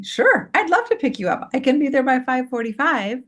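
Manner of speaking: high, sweetly obliging voice; very nice, kind. Shown as a demonstration. Mom agreeing to pick up her son, very kindly. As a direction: agree gladly, with a high lilting pitch. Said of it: "very nicely, very kindly".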